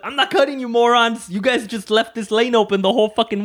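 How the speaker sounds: mock yell at the morons. Retelling his yelling at other drivers, a mock rant. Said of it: "I'm like".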